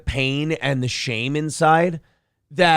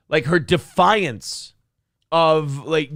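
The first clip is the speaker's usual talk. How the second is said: normal talking voice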